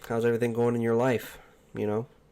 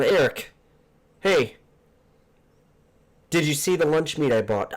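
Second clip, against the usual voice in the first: housemate voice; 6 semitones higher, 5 dB louder than usual. Retold or imitated. Imitates housemate interrupting him.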